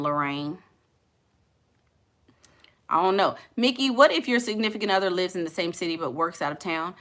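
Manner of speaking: normal talking voice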